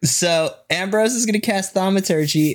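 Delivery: ordinary delivery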